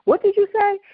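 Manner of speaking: mock-shocked questioning, jumping high; retold shock. Friend hears the pregnancy confession on the train, acted shocked. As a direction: act out a stunned friend. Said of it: "obviously shocked".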